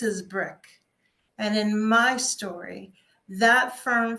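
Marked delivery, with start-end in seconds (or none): none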